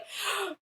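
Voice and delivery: sharp gasp of shock. Retold or imitated.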